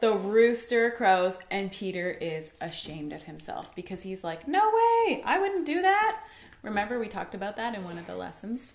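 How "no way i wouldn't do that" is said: high, louder protest; mock indignant denial. Peter insists he would never deny Jesus, protesting. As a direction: push the pitch up in disbelief.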